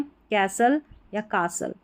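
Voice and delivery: slow "कैसल" with ae, "कासल" with aa; two accepted pronunciations. Shown as a demonstration. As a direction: say "कैसल" then "कासल", t silent. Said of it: "दोनों तरीके से आप pronounce".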